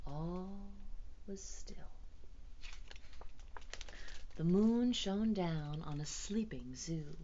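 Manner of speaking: usual speaking voice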